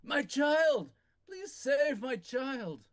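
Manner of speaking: put-on high, loud cry for his child; a father's anguished cry, slow at the end; desperate plea. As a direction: beg for your child's life. GM voices desperate lord.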